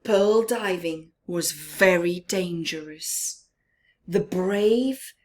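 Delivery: ordinary delivery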